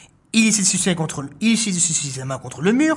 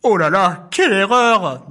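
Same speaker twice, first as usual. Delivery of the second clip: louder rueful groan; dismayed; 4 dB louder, slower than usual. Acted. Animals squeezed by the boar, mock dismay. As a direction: regret letting him in. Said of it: "dit-il en chœur".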